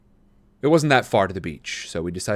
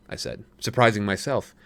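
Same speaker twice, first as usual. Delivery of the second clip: ordinary delivery, nothing marked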